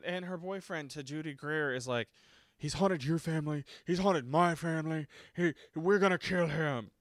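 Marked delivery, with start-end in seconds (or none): 2.6-6.9 higher, emphatic hammed-up declaration; mock dramatic vow. Imitating the boyfriend vowing to kill, hammed-up. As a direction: declare it with overblown resolve.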